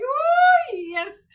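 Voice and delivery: very high, loud squeal of triumph; ecstatic oh yes. Retold or imitated. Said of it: "I was like".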